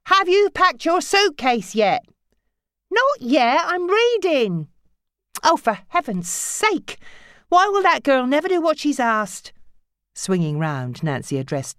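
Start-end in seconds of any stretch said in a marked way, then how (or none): 0.1-9.5 mother calling up and daughter answering; exasperated mother and teen. Acted. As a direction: play the nagging mother and the bookish girl.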